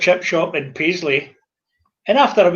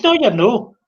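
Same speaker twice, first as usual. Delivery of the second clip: a retold flat refusal, a little raised and higher; huffy refusal; 5 semitones higher, 3 dB louder than usual. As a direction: slap down the customer's idea, half in mock anger. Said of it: "when I'm huffed".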